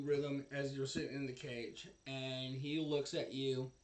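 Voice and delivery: normal talking voice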